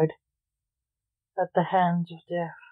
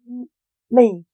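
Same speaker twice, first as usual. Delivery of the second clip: a frightened son forces out a stammer, higher; stammered, terrified; 4 semitones higher, 4 dB louder than usual. Acted. Terrified son stammers his confession to his father. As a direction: stutter out the word in fear. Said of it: "was all he could eventually expel".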